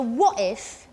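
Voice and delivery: "what" hit first, "if" lighter; strong-weak "what if", like dum-di; stress on WHAT. Shown as a demonstration. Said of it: "is different than dumdi".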